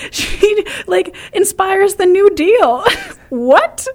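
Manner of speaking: very high, incredulous squeal through laughter; laughing disbelief. Real, not acted. Host reveals the absurd plot to co-host, laughing incredulously. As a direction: draw it out, cracking up in disbelief.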